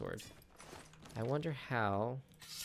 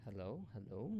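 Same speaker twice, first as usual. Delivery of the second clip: softer, questioning hello into a dead mic; mic check; 10 dB quieter than usual. Real, not acted. Streamer checking the mic after cutting out, softer. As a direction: test whether the mic is back.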